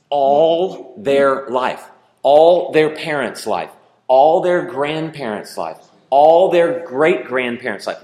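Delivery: slow, loud, pounding "all" each time; building preacher questions. Acted. Preacher driving home the years of slavery, slow and loud. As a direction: hammer the generations one by one.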